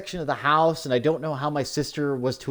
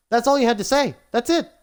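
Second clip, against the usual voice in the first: exasperated outburst; 9 semitones higher, 4 dB louder than usual. Real, not acted. Exasperated at sister's vague note.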